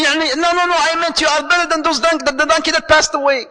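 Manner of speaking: high-pitched protesting correction; acted backtracking. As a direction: scramble to take back an accidental insult. Acted wife hurriedly backtracks to husband.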